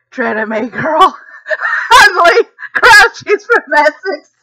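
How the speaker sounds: reading chat while cracking up; laughing aloud at the comments. Real, not acted. Laughing, mocking chat reactions.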